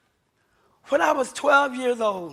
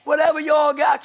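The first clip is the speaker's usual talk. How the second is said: louder, higher retold challenge; cool defiance; 4 semitones higher, 6 dB louder than usual. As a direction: imitate a mother coolly standing her ground. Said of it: "My mother was cool".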